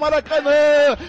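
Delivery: ordinary delivery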